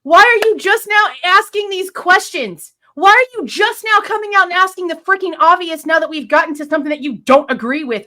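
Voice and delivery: really angry, spell out each word; pissed off. Real, not acted. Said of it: "now I'm just pissed".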